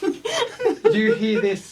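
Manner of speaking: cracking up mid-question; laughing. Real, not acted. DM laughs while asking the players.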